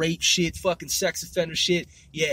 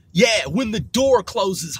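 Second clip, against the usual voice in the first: usual speaking voice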